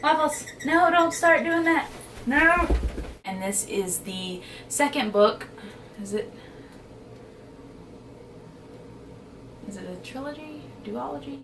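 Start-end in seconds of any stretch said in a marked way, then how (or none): 0.0-2.7 high-pitched telling-off; scolding a pet. Real, not acted. Book vlogger interrupts recording to tell off a pet, high scolding voice. 6.0-11.4 soft, tentative aside to oneself; quiet self-questioning. Real, not acted. Vlogger checks a series fact between takes, quiet and unsure.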